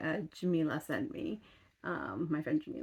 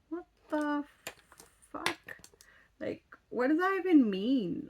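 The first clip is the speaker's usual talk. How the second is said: incredulous outburst; 8 semitones higher than usual. Retold or imitated. Retelling her outrage at the guy.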